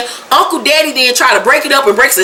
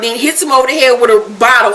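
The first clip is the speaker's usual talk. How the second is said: ordinary delivery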